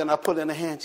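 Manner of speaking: ordinary delivery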